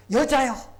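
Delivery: loud, high burst of amazement; big admiring exclamation; exclaiming in admiration. Shown as a demonstration. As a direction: call out as if astonished by her. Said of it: "거기 감탄사가 있어".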